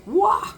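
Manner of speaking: sudden high yelp from the heat; startled yelp. Real, not acted. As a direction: yelp at something hot. Touches hot jar.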